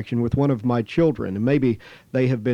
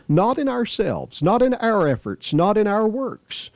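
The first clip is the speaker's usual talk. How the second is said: not in ourselves, not in our efforts, not in our works, each raised high; emphatic list; 6 semitones higher than usual. Real, not acted. Preacher to congregation, each not raised high. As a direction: drive each not home, pitched high.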